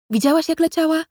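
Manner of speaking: mildly irritated high question; a touch of impatience; understated annoyed ask. Acted. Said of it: "spytał zirytowany".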